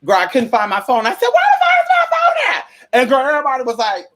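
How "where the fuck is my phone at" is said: shrieked much higher and louder; panicked scream. Retold or imitated. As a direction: yell it across the store for your lost phone. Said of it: "If you scream".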